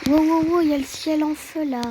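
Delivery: loud, high cries of astonishment; startled alarm. Real, not acted. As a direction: react in shock to a sudden sight. Sudden burning sky in game. (stupéfaction, voix forte et aiguë)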